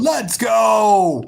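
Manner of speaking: punchy celebratory yell; cheer. Real, not acted. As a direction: fire up the crowd. Cheering after goal.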